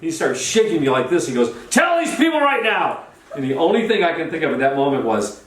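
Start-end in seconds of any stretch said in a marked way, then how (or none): 1.7-3.0 very high, insistent holler; mock hollered demand. Charlie shakes him before the crowd, mock hollered demand. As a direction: imitate a frantic order, pitch way up.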